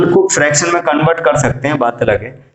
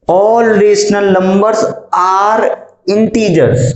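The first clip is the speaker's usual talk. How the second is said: very slow, word by word; stretched-out rereading of the question; slow dictation; 5 semitones higher, much slower than usual. Shown as a demonstration. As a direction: spell the statement out for struggling students. Rereads statement for the class.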